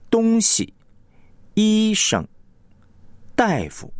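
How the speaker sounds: 东西, 医生, 大夫 with 东, 医, 大 full and the second syllables light; front-heavy demo of 东西, 医生, 大夫; stress on first syllable. Shown as a demonstration. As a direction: punch 东, 医 and 大, let the tails drop. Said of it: "一般呢是重点在前".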